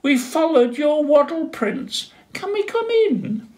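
very high, loud, squeaky sisters; tiny high sister penguins calling. Acted. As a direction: chirp it cheerfully through the door. Sisters ask to enter.

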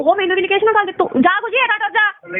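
real heated scorn, pitched high; angry retort. Real, not acted. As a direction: snap back at him in the fight. Angry retort in a phone quarrel.

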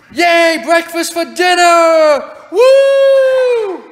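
loud, drawn-out celebratory whoop; cheering. Acted. Dad celebrating breakfast for dinner, loud cheering. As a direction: cheer it out, stretching the words.

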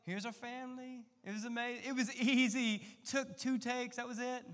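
high, breezy fake-easy voice; mock perfect-family caption. Family showing off the edited photo, breezy and mock proud. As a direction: pretend it was effortless.

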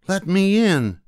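mother demanding at the witch's hut, very high and loud; calling out. Acted. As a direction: demand at the door. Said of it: "the mother called".

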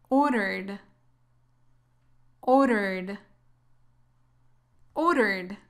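Ordered ending in RD, with no vowel before the D; silent E. Shown as a demonstration. Teacher asking learners to listen for the silent E, careful pronunciation. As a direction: model Ordered carefully, dropping the final E. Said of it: "La E no se pronuncia".

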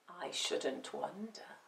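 slow, low, very soft cat voice; cool, secretive cat; sly cat. A narrator's reading. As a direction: answer knowingly under the breath. Cat's sly aside.

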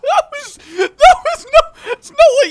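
high loud shriek into laughter; startled scream. Real, not acted. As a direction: yell in real shock then laugh. Startled by trap.

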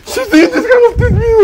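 high-pitched tearful wail; sobbing, voice pushed way up high; crying. Acted. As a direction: cry it out, hurt and complaining. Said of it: "Why are you crying?".

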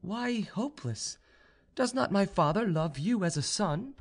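high-pitched, questioning heroine; young noblewoman. A narrator's reading. Proud young woman questions her suitor's apology, high-pitched. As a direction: voice her high, gently challenging him.

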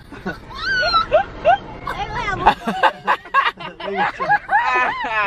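long wheezing high laugh; a long helpless laugh. Real, not acted. Dad cracking up at Bird's antics.

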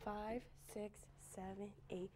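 very soft, near-whispered counting; hushed count-in. Real, not acted. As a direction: count the class in under your breath. Quiet count-in before clapping.

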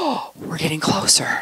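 gasp, then soft and pleased; breathy little gasp of delight; mock delighted gasp. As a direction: act pleasantly surprised.

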